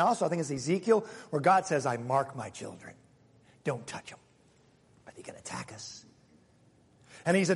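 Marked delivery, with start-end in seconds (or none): 1.9-4.2 quiet. Acted. God's words voiced quietly. 5.1-6.0 breathy unvoiced whisper; a secret whispered to the church. Whispered line in quote.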